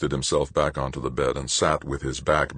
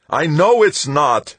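high, louder and slow anguished cry; distraught patient insisting to the nun; wailing; 12 semitones higher, 8 dB louder, slower than usual. A narrator's reading. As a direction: wail it in despair. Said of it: "he wailed".

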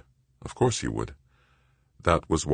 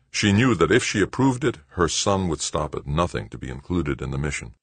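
ordinary delivery

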